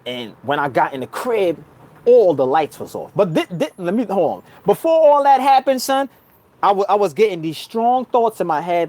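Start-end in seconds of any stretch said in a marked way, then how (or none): none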